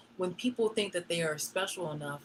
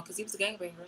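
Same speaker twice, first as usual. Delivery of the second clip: ordinary delivery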